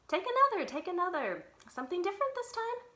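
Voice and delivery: put-on high, eager old bookseller pressing a book; eager Book Uncle. Book Uncle urges girl eagerly.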